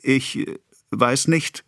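halting, timid mumble; stammering apprentice. A narrator's reading. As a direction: stumble over the answer. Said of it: "stammelte der Lehrling".

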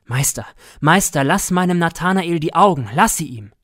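a father crying out for his son's eyes; pleading cry. A narrator's reading. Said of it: "hob mein Vater flehend die Hände empor und rief".

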